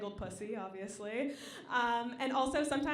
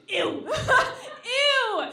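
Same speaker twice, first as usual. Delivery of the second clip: high, loud squeal of disgust; mock-revolted ew; 10 semitones higher, 11 dB louder than usual. Real, not acted. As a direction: recoil at the name. Disgust at the name Gilbert.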